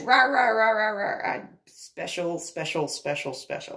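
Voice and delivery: high, loud gibberish imitation, easing off; mocking his rant. Homeowner imitating the angry man's rant, mocking. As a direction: parody someone ranting in nonsense syllables.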